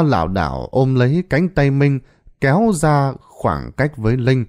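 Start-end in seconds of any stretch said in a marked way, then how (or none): none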